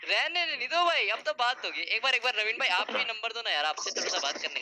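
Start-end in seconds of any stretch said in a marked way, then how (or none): 0.1-2.7 angry outburst. Real, not acted. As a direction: refuse to drop it, voice jumping up hard. Heated online argument with rival. (प्रतिद्वंद्वी को ऊँची, गुस्से भरी आवाज़ में जवाब)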